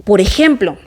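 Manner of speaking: ordinary delivery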